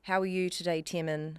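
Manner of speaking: New Zealand accent with squeezed vowels; Kiwi accent. Shown as a demonstration. Guest demonstrating on the host's request, Kiwi accent. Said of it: "in a Kiwi accent".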